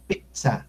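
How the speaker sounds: pizza with an abrupt stop before sa; cut airflow. Shown as a demonstration. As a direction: chop pizza in two, pit, sa. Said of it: "cortamos el aire para decir pizza".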